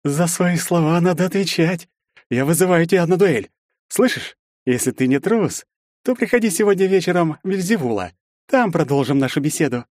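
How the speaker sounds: tense, high-pitched threat to his face; menacing challenge. A narrator's reading. Bully challenging the hero to a duel, menacing. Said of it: "Парень процедил в самое лицо".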